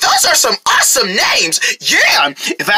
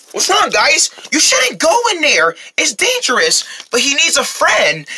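ordinary talk

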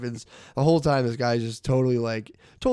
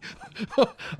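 airy laugh high up; breathy high laugh; 11 semitones higher than usual. Real, not acted. Comic cracking up retelling the dog in the car. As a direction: wheeze out a high giggle.

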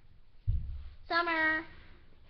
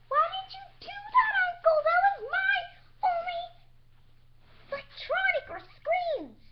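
high-pitched wail of protest; upset whining; 10 semitones higher than usual. Acted. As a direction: whine in hammed-up dismay. Upset over laptop thrown away.

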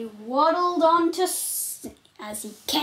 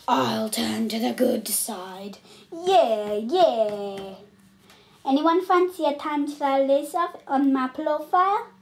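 ordinary talk

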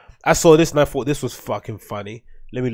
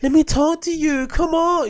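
much higher mock girl voice, wheedling; imitating the girl; 13 semitones higher than usual. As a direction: copy her coaxing in a high put-on voice. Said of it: "she's like".

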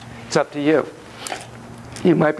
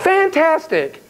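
excited; 10 semitones higher, much slower than usual. Acted. Praising slowly.

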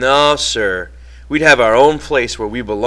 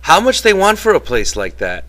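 excited; 9 semitones higher, 4 dB louder than usual. A narrator's reading. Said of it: "Candy went on excitedly".

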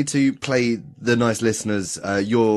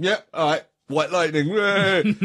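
mock drunken cheer; 8 semitones higher than usual. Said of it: "you went out going".

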